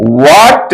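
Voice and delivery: "what" with the h left silent, said "वाट". Shown as a demonstration. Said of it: "एच silent होता है". ("what" में एच मत बोलो, "वाट" कहो)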